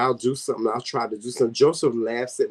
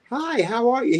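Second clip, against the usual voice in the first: bubbly greeting; 9 semitones higher than usual. Retold or imitated. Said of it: "you meet and you be like".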